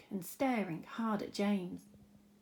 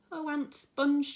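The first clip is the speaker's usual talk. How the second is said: higher, slow plea; pleading boy; 6 semitones higher, 5 dB louder, slower than usual. Acted. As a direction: beg like a small boy. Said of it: "James cried out".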